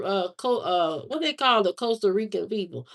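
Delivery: ordinary delivery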